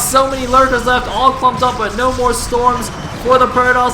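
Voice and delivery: high, loud climactic esports call, easing at the end; full-voice battle commentary, slowly coming down; shouted play-by-play. Real, not acted. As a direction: shout the carnage in drawn-out phrases.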